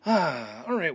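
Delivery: ordinary talk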